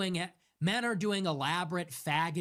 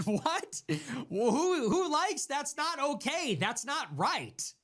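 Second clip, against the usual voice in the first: ordinary talk, nothing marked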